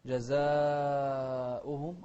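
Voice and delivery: جزاؤهم chanted slowly with a long "zaa"; drawn-out chanted جزاؤهم; four-count madd. Shown as a demonstration. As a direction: stretch the a of جزاؤهم before the hamza. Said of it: "أربع حركات".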